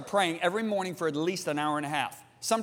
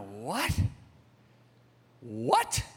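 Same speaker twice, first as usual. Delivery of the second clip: low, hushed 'what?' rising at the end; baffled disbelief; 7 semitones lower, 3 dB quieter than usual. Retold or imitated. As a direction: ask it under your breath, thrown. Stunned reply to God, retold.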